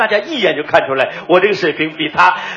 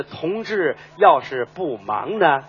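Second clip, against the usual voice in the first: drawn-out slow dialect line; 4 semitones lower, 4 dB quieter, much slower than usual. Shown as a demonstration. Partner demands it even slower. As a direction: spell it out word by word, slowly. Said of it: "再，再慢点".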